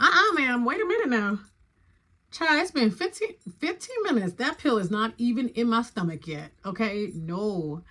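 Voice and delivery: high, loud sassy pushback; sassy protest. Retold or imitated. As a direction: tell the nurse to hold it right there. Protesting to nurse, not ready.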